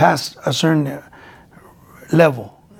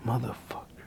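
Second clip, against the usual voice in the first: bitter whisper; 6 semitones lower, 18 dB quieter than usual. Retold or imitated. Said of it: "I said".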